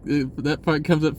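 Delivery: ordinary delivery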